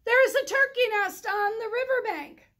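high, loud sheep voice; sheep calling. Acted. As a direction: call out the news. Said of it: "Sheep called".

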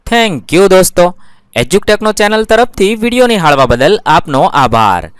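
loud, bright-voiced thank-you and subscribe pitch; energetic outro announcer. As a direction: sell the subscribe call like a YouTube host.

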